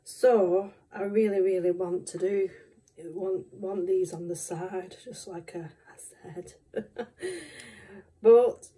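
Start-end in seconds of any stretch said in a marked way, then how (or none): 5.0-6.5 soft whispered aside; whispering. Real, not acted. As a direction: drop to a whisper.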